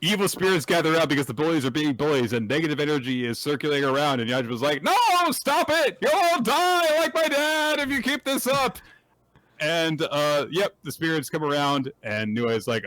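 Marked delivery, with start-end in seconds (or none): 4.8-8.8 hammed-up high plea; pleading hero. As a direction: beg them to stop like a scared boy. Voicing Yajima pleading.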